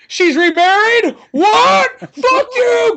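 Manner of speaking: high, loud hammed-up yell; hammed-up furious cry, high and loud; mock outraged shout. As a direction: scream it like a betrayed drunk. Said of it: "He's like".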